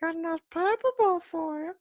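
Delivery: very high, squeaky mocking voice; joking squeaky imitation. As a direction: parody the accent in a squeaky falsetto. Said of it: "mocking the co-host's accent".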